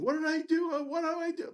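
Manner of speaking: very high imitated tearful whine; mock crying. Threatened resident breaking down before the student, mock crying. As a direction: mimic a blubbering plea way up high. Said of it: "literally crying".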